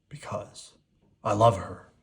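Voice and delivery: sighed, half unvoiced. A narrator's reading. Beast sighing his love to Cogsworth. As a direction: let the words fall out on a sigh. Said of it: "sighed the beast".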